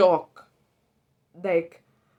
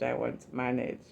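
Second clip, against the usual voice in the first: softer, dying-away I won't manage; quieter, defeated I won't manage; fading resignation; 7 semitones lower, 7 dB quieter than usual. Retold or imitated. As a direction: let I won't manage trail off, beaten. Despairing on first day.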